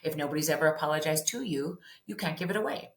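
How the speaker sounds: ordinary delivery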